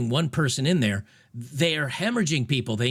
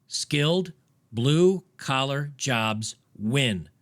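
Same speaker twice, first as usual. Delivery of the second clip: each word set down slowly and firmly; emphatic slow pronouncement; slow final verdict; much slower than usual. Real, not acted. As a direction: deliver it like a closing ruling, slowly. Closing verdict of the debate.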